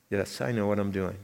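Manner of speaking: reply as God, quietly; calm answer as God. Retold or imitated.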